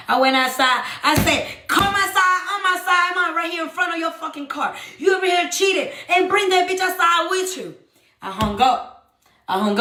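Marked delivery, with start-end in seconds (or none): 1.7-7.8 high, heated demand; angry confrontation. Retold or imitated. Woman on phone to cheating partner, angry demand. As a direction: order them out, high and insistent.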